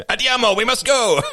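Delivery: high, loud hammed-up call; mock sportscaster shout. Sportscaster unable to talk normally, high mock shout. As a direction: belt it out like a goal call. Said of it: "They just can't do it".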